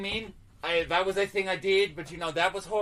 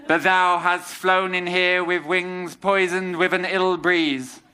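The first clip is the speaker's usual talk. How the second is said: mock-Shakespearean betrayal speech; 2 semitones lower, 6 dB louder, slower than usual. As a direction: declaim it as a wounded tragic hero.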